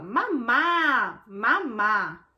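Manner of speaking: put-on calm, even "Mamá, mamá" said gently to a baby; calm mother. Shown as a demonstration. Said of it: "com um tom de voz mais, mais calmo, mais tranquilo".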